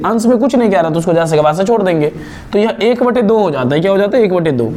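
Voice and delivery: normal talking voice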